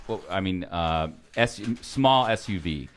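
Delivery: usual speaking voice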